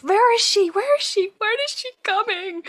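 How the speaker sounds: loud, high repeated calling that fades a little; high panicked yell. Retold or imitated. Mother in labour demanding where the midwife is, high panicked calling. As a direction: call out in rising panic for the midwife. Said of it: "I screamed in between".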